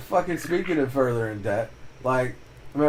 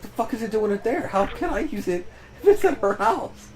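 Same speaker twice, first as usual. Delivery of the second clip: higher, fast, rising mock complaint; exasperated question; 9 semitones higher than usual. Asking his mom where the chair is, exasperated. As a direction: question it in disbelief.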